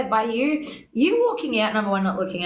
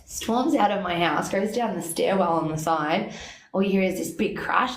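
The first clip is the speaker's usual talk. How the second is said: ordinary delivery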